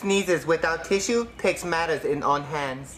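low proverb in a put-on Chinese accent; mock Chinese accent. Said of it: "my name is Doctor Wong".

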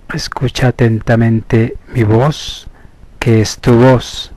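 slow, measured hypnosis voice with long pauses; guided meditation. Acted. Therapist leading listeners into hypnosis through slow breathing, measured meditation voice. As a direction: guide them inward, stretching the counts and key words.

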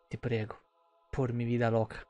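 ordinary talk

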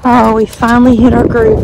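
ordinary delivery

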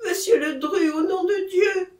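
loud, high-pitched appeal; anguished cry for help; desperate plea. Acted. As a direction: implore him as if close to tears. Terrified man begs to leave.

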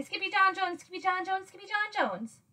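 tongue-twister run in a high voice; quick repetition. A narrator's reading. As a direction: rattle it off as one quick chant. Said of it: "Quicker than you can say".

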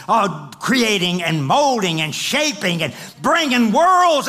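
loud, high, driving sermon climax; preaching at full force. Acted. Preacher stirring up the congregation, loud and rising. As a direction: preach it out to the back row, pushing hard.

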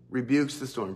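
ordinary talk

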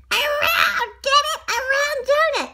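high, punched-out joke delivery; hammed-up pun. Reader shows off a round donut pun, hammed-up and high. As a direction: sell the silly pun loudly and high.

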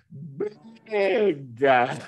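Benedda said very slowly, Sicilian style; drawn-out Sicilian Benedda; sicilian dialect. Shown as a demonstration. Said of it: "ve la dico in dialetto siciliano".

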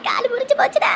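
high, loud hammed-up wailing; faked pain wail. Mother tells son her leg broke, loud mock wail.

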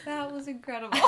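real laughter through the words; words breaking into laughter; laughing. Real, not acted. Cracking up after the joke.

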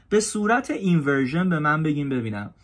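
ordinary talk, nothing marked

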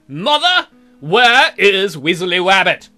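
slow, loud mock-stern interrogation; stern demand. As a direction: confront mother like a stern little lord. Confronting Mom about missing toy.

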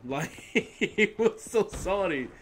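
can't hold back the giggles while saying it; laughing while talking. Real, not acted. Laughing to viewers about the angry player.

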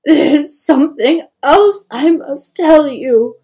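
distraught mother in tears; sobbing. A narrator's reading. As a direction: force the words out through sobs. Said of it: "she sobbed".

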